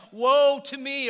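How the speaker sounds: loud, high cry of woe. Acted. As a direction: cry it out like a stricken prophet. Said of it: "often their first response was the same as Isaiah".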